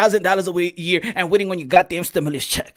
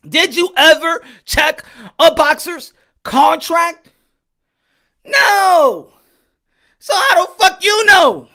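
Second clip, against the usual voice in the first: loud, higher, slow pointed yelling; angry grilling; 8 semitones higher, 8 dB louder, much slower than usual. Real, not acted. Vlogger grilling a fan about contracts, angry. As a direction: hammer the question home.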